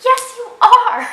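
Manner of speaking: high, loud mock-indignant yes you are; exasperated retort. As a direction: shoot back at him, way up and loud.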